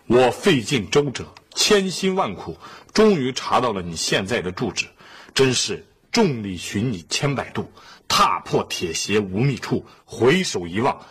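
measured, declaimed letter reading; letter read aloud. Acted. Father reading a mysterious letter to the family, declaimed. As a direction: recite it phrase by phrase, pausing between.

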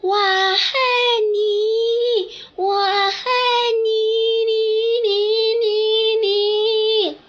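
loud echoes fading to repeated 你; canyon echo. Acted. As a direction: sound like echoes all around. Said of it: "整个世界传来的声音都是".

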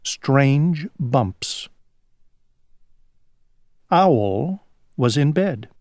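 usual speaking voice

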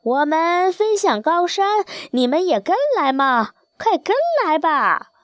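wild geese calling down. A narrator's reading. Wild geese inviting tame geese to the mountains, high calling. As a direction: coax them to follow, pitched high. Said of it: "往下呼唤".

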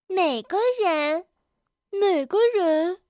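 put-on high, childlike surprised questioning; astonished disbelief. A narrator's reading. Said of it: "惊讶地问". (童声高调，惊讶又不敢相信)

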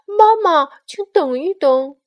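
high, very loud call after someone; running shout. Acted. Little bear runs after mama, shouting. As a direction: cry out to stop someone leaving. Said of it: "它一边跑一边喊".

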